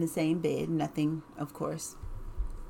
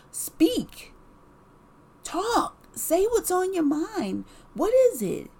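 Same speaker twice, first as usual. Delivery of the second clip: put-on mom to toddler; 10 semitones higher, 5 dB louder than usual. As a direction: coax him like a small child. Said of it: "talking to him like ... my babies".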